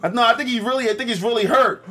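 high-pitched concerned bystander impression.

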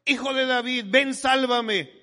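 high, loud desperate plea; drawn-out, high-pitched cry for help. Retold or imitated. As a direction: shout it out like calling through a crowd. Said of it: "un hombre que le gritaba".

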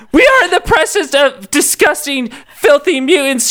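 high, slow, prissy outrage; hammed-up scandalized society lady. A narrator's reading. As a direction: gasp it out like a fainting socialite. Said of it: "she yelled while fanning herself".

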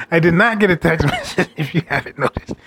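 words broken by real laughter; laughing while talking. Real, not acted. Vlogger admits to viewers, laughing. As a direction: laugh through the admission. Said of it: "laughing through his admission".

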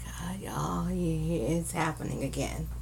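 very soft, low near-whisper; hushed mock dread. Host confides in listeners it's happening again, hushed mock dread. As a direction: drop to a hushed, breathy aside of pretend dread.

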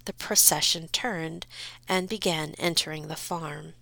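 usual speaking voice

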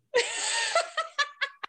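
very high giggle; laugh. Real, not acted. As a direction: break into high-pitched giggles. Laughs at crochet doll joke.

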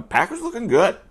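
Packers looking good sung out high; a high, excited lift on looking; excited cheer. Real, not acted. Packers fan cheering the team's start, high and excited.

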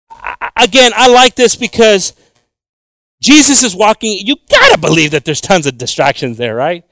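normal talking voice

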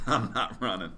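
laughing through the words. Retold or imitated. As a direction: chuckle while refusing. Laughing while refusing to run.

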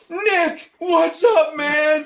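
hammed-up high-pitched hello; excited greeting. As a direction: imitate a friend thrilled at a chance meeting. Imitates old friend's excited greeting.

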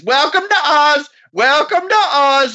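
high, drawn-out mock announcer cry; imitating a costumed greeter's repeated high call; masked old man. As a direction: belt it out high and slow, mimicking the old man. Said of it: "this fucking old man in a rubber mask is".

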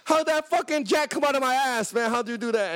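high loud shouting astonishment; amazed street magic reaction. Retold or imitated. Reacting to the tow guy like a street magician, high amazed shouting. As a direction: yell like you just saw a magic trick.

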